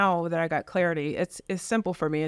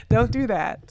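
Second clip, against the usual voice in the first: louder, laughing through the words; laughing scold; 5 semitones higher, 6 dB louder, slower than usual. Real, not acted. Agent telling clients not to blame her, laughing and louder. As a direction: scold playfully while laughing.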